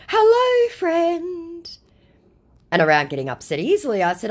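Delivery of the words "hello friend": very high, sing-song greeting; mock scammer voice. Scammer's cheery hello, mocked very high. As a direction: chirp it like a fake friend.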